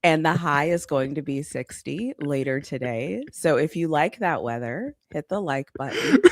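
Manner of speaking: subdued mock weather report; imitating ad delivery. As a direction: parody a restrained ad read. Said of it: "Why you talking like".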